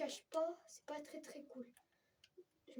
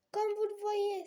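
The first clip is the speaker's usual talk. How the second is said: excited exclaim; 8 semitones higher, 9 dB louder than usual. Real, not acted. Excitation en jouant.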